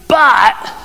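"but" punched high and loud; emphatic but. Acted. Jesus loves a party, then a loud, high "but". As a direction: hit "but" hard, then stop.

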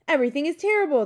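loud, high outburst; panicked. A narrator's reading. King of Sweden answering the Queen, panicked. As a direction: burst out in alarm.

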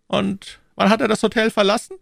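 high, cool and unhurried cardinal; phlegmatic cardinal. A narrator's reading. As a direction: say it with utter composure. Cardinal voiced.

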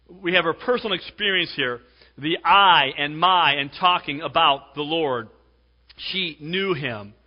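ordinary delivery

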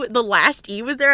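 ordinary talk, nothing marked

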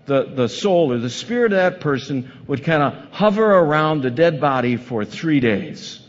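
normal talking voice

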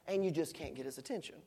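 ordinary talk, nothing marked

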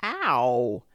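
loud, drawn-out yelp; pained cry. A narrator's reading. Pooh as they all pulled together, pained cry. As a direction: yelp as if being tugged hard. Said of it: "Pooh only said".

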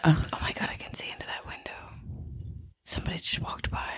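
hushed, startled whisper. Real, not acted. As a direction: whisper so nobody hears. Peering at a window at night.

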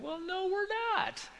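high squealing, drawn-out reply; excited. Retold or imitated. Husband replying to his wife's hint, giddy and excited. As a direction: play up the giddy delight, way up high.

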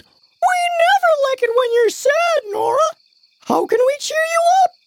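a tiny squeaky piece of furniture; talking dresser. Acted. Dresser talks to Nora.

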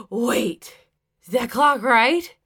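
put-on slurred, puzzled drunk voice; drunk husband. A narrator's reading. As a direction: ask it with tipsy surprise.

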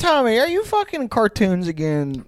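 put-on high, teasing kid voice; mock grandkid. Grandkid asks Grandpa about cartoons, high mock-kid voice. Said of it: "Your grandkids say".

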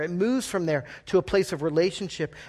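usual speaking voice

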